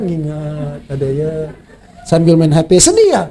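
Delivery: ordinary talk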